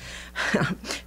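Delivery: quiet breathy chuckle; a knowing little laugh; soft chuckle. Acted. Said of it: "I know he chuckled".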